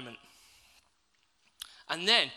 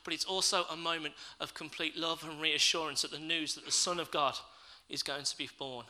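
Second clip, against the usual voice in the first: normal talking voice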